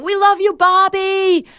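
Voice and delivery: high, loud mock cheer held on the last word; imitated crowd yelling. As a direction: call it out like a fan in the stands. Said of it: "they were yelling".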